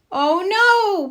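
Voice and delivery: high, loud oh no; dismayed cry. A narrator's reading. Child finding a gap in her teeth, high dismayed cry. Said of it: "Peppa cried".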